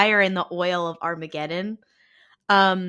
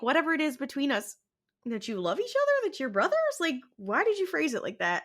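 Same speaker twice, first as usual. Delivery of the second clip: higher, quick, softer teasing disbelief; mock incredulity; 5 semitones higher, 5 dB quieter, faster than usual. Podcaster questions the brothers' odd wording, mock-incredulous. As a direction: question it in a raised, hurried voice.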